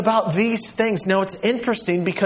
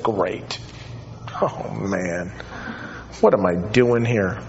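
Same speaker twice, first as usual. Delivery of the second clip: dismayed listener; 9 semitones lower, 5 dB quieter, slower than usual. Retold or imitated. Imagined wife groaning at sermon.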